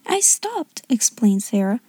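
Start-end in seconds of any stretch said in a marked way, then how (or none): 0.0-0.8 put-on high, softer little-girl voice; high-pitched schoolgirl; young girl explaining. A narrator's reading. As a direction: explain politely as a small girl. Child explains herself to headmistress.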